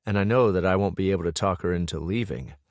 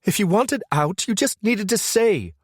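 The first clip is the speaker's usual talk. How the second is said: female voice; 14 semitones higher, 4 dB louder than usual. A narrator's reading. Brooke accusing.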